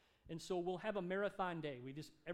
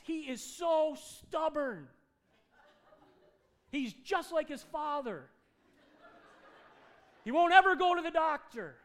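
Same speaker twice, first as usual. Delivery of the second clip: loud, high, drawn-out wail of exasperation; hammed-up sobbing complaint, slow and loud; frustrated bawling wife; 9 semitones higher, 15 dB louder, slower than usual. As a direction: imitate a wife wailing in frustration. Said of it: "She's so frustrated".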